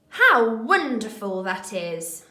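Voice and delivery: expressive exclamation. Shown as a demonstration. Teacher reading a quiz sentence aloud, slow and expressive. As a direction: savour it as something truly wonderful. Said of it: "using our expression".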